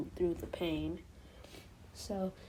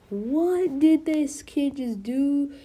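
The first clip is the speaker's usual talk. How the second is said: slow, loud, higher, stunned; shocked disbelief; 6 semitones higher, 6 dB louder, slower than usual. Retold or imitated. All Might reacting to Deku, stunned and disbelieving. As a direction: gasp it out like you can't believe your eyes.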